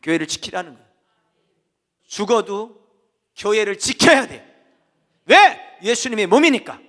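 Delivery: loud, driving sermon delivery; full-force preaching. Acted. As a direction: preach it hard from the pulpit.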